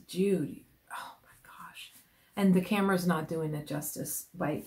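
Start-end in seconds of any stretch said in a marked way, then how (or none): 0.1-1.9 hushed wonder to a friend; awed whisper. Real, not acted. Thanking Jude, whispered amazement. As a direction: whisper her name in awe.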